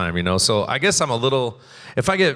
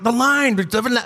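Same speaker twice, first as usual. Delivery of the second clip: exasperated outburst; 13 semitones higher, 5 dB louder than usual. Retold or imitated. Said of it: "we're like".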